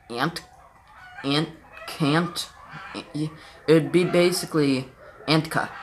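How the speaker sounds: can't sounded out backwards as "antka", slowly; backwards speech. Shown as a demonstration. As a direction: break can't apart into ant and ka, said backwards. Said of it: "try and sound it out backwards".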